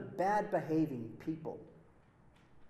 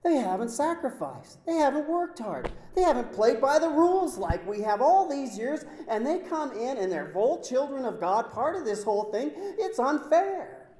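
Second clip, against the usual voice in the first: rising indignant grievance, louder and higher; resentful complaint; 7 semitones higher, 9 dB louder than usual. Retold or imitated. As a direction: build to an outraged "unfair". Voicing resentful insiders' complaint.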